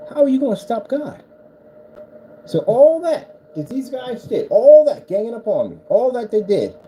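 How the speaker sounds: fired-up preaching. Real, not acted. Preaching fervently about God.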